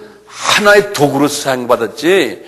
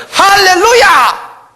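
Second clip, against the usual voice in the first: mock hallelujah shout; 6 dB louder, much slower than usual. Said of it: "이 오순절파들은 어떻게 합니까".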